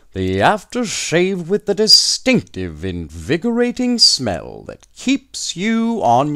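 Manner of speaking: Mister Driggs proudly reading out the slogan; salesman's slogan pitch. A narrator's reading. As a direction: sell it like a radio pitchman. Driggs proclaims the winning slogan.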